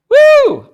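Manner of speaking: very high, very loud joyful whoop; an ecstatic pulpit woo; exultant whoop. Real, not acted. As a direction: let out a celebratory holler. Preacher exults after the verse.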